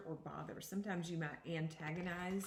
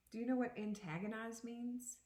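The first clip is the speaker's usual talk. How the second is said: usual speaking voice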